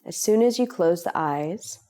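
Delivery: calm, unhurried meditation voice with long pauses; guided meditation. Real, not acted. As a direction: guide them gently into relaxation, leaving space between phrases. Leads a guided relaxation meditation.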